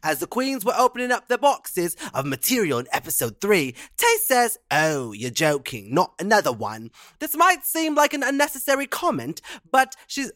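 Canadian doing a hammed-up British accent; mock British accent. Reading a UK listener's email in a mock British accent. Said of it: "read it in Amerisha accent".

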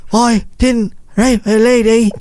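high hammed-up British accent, Mike Myers style; mocking a man's fake British accent; Mike Myers character. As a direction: play a silly British Mike Myers caricature. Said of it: "He sounds like a Mike Myers character".

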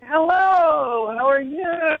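very high, gushing mock hello; delighted greeting. Wife greeting the celebrity chef at their table, mock delighted. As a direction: imitate someone lighting up at a celebrity. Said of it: "my wife's face lights up".

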